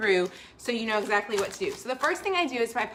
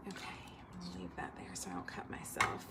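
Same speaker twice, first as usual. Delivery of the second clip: whisper; 9 semitones lower, 16 dB quieter than usual. Real, not acted. Aside while handling the can.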